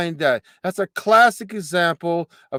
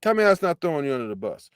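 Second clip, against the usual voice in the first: rattled off fast and softer; fast quiet repeat; 3 semitones lower, 4 dB quieter, much faster than usual. Real, not acted. Host repeats his challenge to himself, fast and softer. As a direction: mutter it again at speed.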